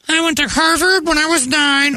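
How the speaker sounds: put-on very high bragging child voice; mock preteen doctor. Host voicing a preteen doctor bragging to a patient, very high. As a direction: brag like a smug little kid. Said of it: "And a preteen walks in".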